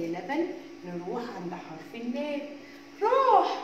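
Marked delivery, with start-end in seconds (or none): 3.0-3.6 excited cheer. Acted. Teacher cheers as letter moves.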